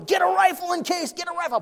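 high, urgent mock cry; imitated frantic onlooker. Bystander calling for a rifle, imitated frantic cry. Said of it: "The guy's going".

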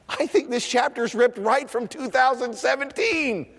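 high-pitched, emphatic pulpit delivery; forceful preaching. Real, not acted. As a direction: preach it out, building to a shout.

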